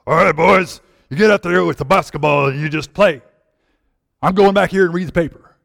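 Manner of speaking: loud coach voice, barking orders; gruff gym teacher. Retold or imitated. Gym teacher sending boys off to play basketball, loud coach voice. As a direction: bark it out like a drill-running gym teacher. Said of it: "yell in your face, gym teacher".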